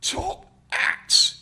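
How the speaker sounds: sudden bright airy exclaim; brightened. Acted. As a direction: perk up at the words. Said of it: "The old man brightened suddenly".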